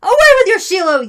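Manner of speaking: high, loud jeering rejection; yelled. A narrator's reading. Crowd rejecting the nominee, yelled. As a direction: yell it as an angry crowd. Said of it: "yelled the crowd".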